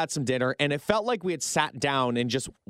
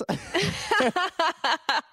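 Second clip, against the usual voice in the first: high burst of laughter breaking out. Real, not acted. Host cracking up after his wife impression, high laugh. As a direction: let the laugh pop up high.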